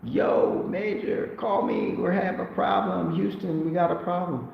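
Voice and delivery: high, hurried plea for help; 1 frantic message. Retold or imitated. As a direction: read the message like someone in a panic. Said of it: "I just saw a message like".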